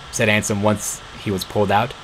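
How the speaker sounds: usual speaking voice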